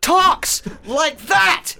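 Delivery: high-pitched, incredulous comic rant; exasperated outburst. Real, not acted. Film fan ranting at absurd movie dialogue, exasperated outburst.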